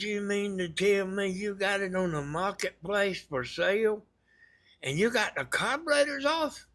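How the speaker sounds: retelling how he couldn't believe it; mock outrage at a careless seller; incredulous retort. Retells his disbelief at seller.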